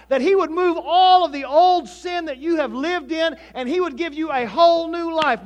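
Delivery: loud, high-pitched preacher's delivery; impassioned preaching. Real, not acted. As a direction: drive the point home at full voice.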